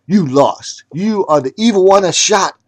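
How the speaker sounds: loud angry shouting. Shown as a demonstration. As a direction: yell it like an accusation.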